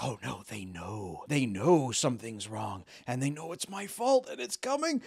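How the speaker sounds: a hint of creeping panic; mock panic. Anxious employee imagining being found out, mock panic. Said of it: "he freaks out like".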